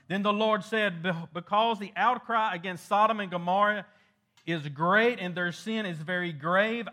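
preacher reading the Lord's words with force; scripture read from the pulpit. Acted. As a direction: proclaim the verse to the congregation.

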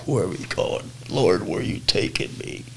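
a hushed, scared whisper to the Lord; whispered prayer. Retold or imitated. Scared in a stranger's van, whispering to the Lord. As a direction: breathe the question out under your breath.

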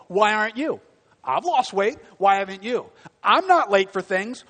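preacher mocking a self-righteous achiever; smug judgment. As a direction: throw each why aren't you like a superior critic. Voices proud judgmental performer.

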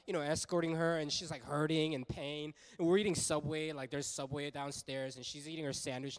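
ordinary delivery

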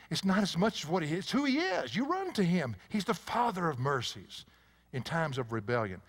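usual speaking voice

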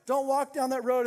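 high, urgent mock warning; alarmed crowd. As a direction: warn them off, voice lifted. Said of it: "people were like".

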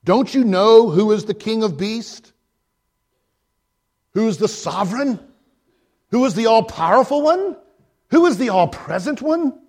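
stern, rising proclamation. A narrator's reading. Mister Beaver sternly telling Lucy who Aslan is, building to a loud climax. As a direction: declare it like a proclamation. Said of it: "said sternly".

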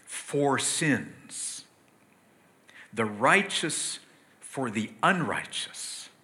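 slow, measured reading of the verse; scripture reading. Acted. Preacher reading the verse to the congregation, slow and measured. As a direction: let each phrase of scripture land.

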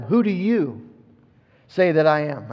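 "you" stressed, then a pause before the rest. Shown as a demonstration. Preacher shows whom Jesus addresses, "you" stressed. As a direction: land on "you" and leave a gap after it. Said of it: "the emphasis is on them".

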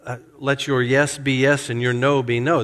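normal talking voice

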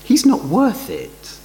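mock scornful disciples. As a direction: voice the grumblers' contempt, dragging it out. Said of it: "effectively they are saying".

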